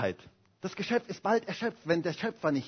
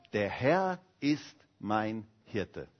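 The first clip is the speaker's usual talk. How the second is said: slow, lower, reverent reading; solemn scripture quote; 9 semitones lower, 6 dB quieter, much slower than usual. Acted. Preacher reading the psalm verse solemnly to the congregation. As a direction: let the verse land slowly.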